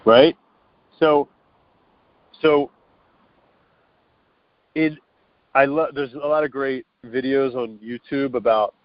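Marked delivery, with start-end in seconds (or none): none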